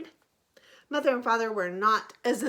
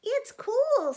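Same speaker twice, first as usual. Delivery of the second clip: high happy little frog; 12 semitones higher, 6 dB louder than usual. Acted. Young frog delighted.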